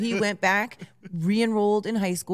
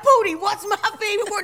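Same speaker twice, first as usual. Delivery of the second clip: high, loud, quick mock hype call; playful call-out; 13 semitones higher, 5 dB louder, faster than usual. Teller replaying a call-and-response with Cammy, playful shout. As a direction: fire off the song's question high and fast.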